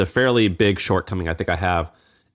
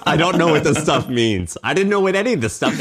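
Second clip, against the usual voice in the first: talking through a laugh, high; laughing at not knowing the jargon; 5 semitones higher than usual. Real, not acted. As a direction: let the laugh carry the words. Laughing while admitting ignorance.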